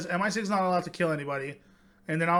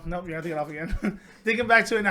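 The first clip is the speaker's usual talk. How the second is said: laughing. Real, not acted. Corrects slip, laughing at himself.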